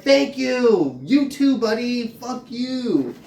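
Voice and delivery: high, sarcastic fake-polite thanks; sweetly sarcastic, then snap; sarcastic retort. Vlogger retells her sarcastic reply to hecklers, high and fake-sweet. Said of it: "I'm like".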